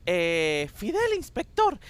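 high, innocent schoolboy answer; Pepito the schoolkid. Retold or imitated. [name] voicing Pepito naming Fidel to the inspector, high kid voice. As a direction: pitch the kid's reply way up. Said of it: "dice Pepito".

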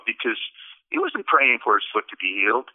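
ordinary talk

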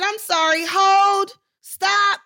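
retold loud interruption; calling a halt. Acts interrupting the scene.

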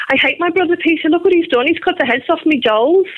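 put-on an upset little Liverpool girl telling on her brother; retold child's complaint in Scouse; Liverpool accent. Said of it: "I did in my Liverpool accent".

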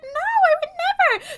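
very high, drawn-out protest; mock coy denial. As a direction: squeal it slowly in fake innocence.

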